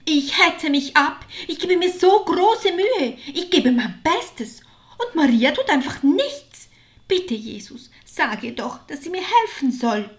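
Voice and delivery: a shaking complaint pleading for help; angry tearful outburst. A narrator's reading. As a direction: Martha pleading with Jesus, upset and loud. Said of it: "Marthas Stimme zitterte ein wenig".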